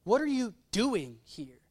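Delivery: DOING lifted after a slight break; slow question leaning on DOING; stress on DOING. Shown as a demonstration. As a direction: set DOING apart and emphasize it. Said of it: "Or what if he said it this way".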